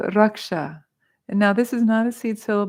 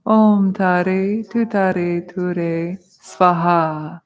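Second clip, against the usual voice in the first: very slow, drawn-out louder chant; mantra recitation; 5 dB louder, much slower than usual. Shown as a demonstration. Teacher leading students who say it after her, slow intoning. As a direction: intone each syllable for a group to follow. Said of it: "Say that after me".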